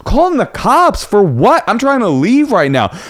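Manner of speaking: very high, incredulous shout; mock indignant husband; mock outraged disbelief. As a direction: play disbelief at absurd threat.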